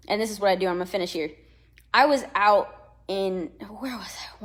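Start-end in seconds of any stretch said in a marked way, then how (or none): 3.7-4.4 whisper. Real, not acted. Aside to self mid-story.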